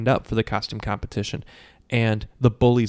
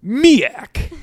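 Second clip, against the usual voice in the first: high, loud triumphant cry; hammed-up victorious shout; cocky reveal; 7 dB louder than usual. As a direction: brandish the word like a secret weapon. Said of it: "he's so cocky".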